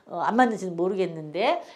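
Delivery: ordinary talk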